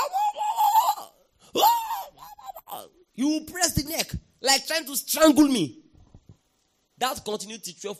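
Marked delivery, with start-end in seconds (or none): 0.0-2.9 high-pitched strangled wailing; imitated struggle, high gargled cries; choked struggling wails. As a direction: wail as if fighting off hands at the throat. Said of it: "And I was just".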